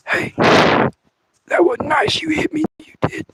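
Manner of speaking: high, slow whispery whine; a wounded little voice complaining; whiny thumb. Retold or imitated. The thumb at night complaining it was hit, high whispery whine. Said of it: "It was like".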